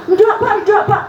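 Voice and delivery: high, loud laughing refusal; protesting refusal. Retold or imitated. She refuses the minister post, laughing. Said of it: "her refusal retold".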